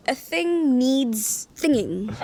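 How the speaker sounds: "A thing needs thinging" said slowly and deliberately; a drawn-out, mocking template; slow mock phrasing. Shown as a demonstration. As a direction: spell out the pattern slowly for effect. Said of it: "you say it in that order".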